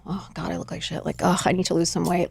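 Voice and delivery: low, quiet self-disgusted groan; self-bashing. Acted. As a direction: mutter it softly, down on yourself. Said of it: "you just start to bash yourself".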